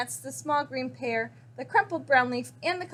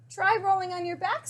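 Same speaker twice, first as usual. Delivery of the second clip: snorted advice; 8 semitones higher than usual. A narrator's reading. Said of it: "snorted Pig".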